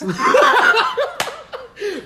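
high, loud burst of laughter; real high-pitched cackling laugh; real laughing fit. Real, not acted. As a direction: crack up at your own story. Cracking up at the point.

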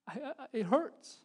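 halting, high and loud mock wail of a hurting child; imitated cry of pain. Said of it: "he's just screaming in agony".

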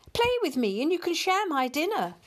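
high-pitched little playful voice; eager kitten. A narrator's reading. Tabby kitten inviting the cat to play, eager.

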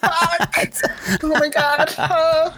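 high, breathless laughing fuck; laughing disbelief. Retold or imitated. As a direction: overcome and laughing at yourself. Overwhelmed by the revelation.

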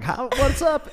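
a cheesy Nashville greeting; Southern accent. Retold or imitated. Leaving Franklin, Tennessee, greeting in a Southern accent. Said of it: "You just performed".

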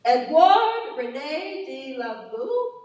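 put-on slow, loud stab at a French accent. Speaker has another go at a French professor's name, slow amateur French accent. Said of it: "Here goes my French again".

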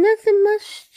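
normal talking voice

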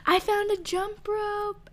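high, slow put-on voice; mock recited lyric. Reading out a lyric prank text, high mock voice. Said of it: "I'm like".

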